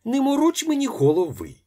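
wife shouting from the room, fed up; irritated shout. A narrator's reading. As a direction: snap at him to stop pestering. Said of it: "закричав жіночий голос".

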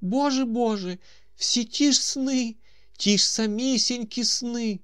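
high, loud wailing complaint; old man's lament. A narrator's reading. Old man bewails his recurring dreams, high wail. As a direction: cry out to God like a weepy old man. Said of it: "Вимовивши цю скаргу, старий заплакав".

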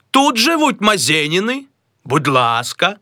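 high, polite inquiry; a high caller at the door. Acted. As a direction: ask the maid politely.

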